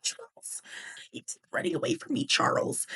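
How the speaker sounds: whispered mock reprimand; hushed scolding. Pretend mom scolds Charles in line, hushed. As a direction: whisper the name as a cover-up scold.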